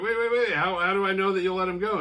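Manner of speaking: high, loud, hurried interruption; urgent protest. Retold or imitated. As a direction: cut in urgently to halt a deal. Victim halts bargain with killer.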